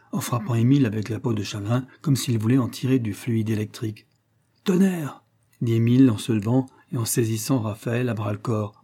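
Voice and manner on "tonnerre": high-pitched exclamation; startled oath. A narrator's reading. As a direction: swear as if jolted out of sleep. Startled sleeper jumps up swearing.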